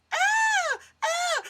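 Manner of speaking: high, loud hammed-up cries; mock sex cries. Noisy neighbours in the next room, mock high loud cries. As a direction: cry out twice, overdone and comic. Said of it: "and they're like".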